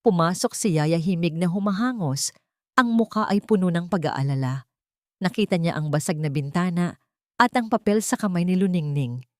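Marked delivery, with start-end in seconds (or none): none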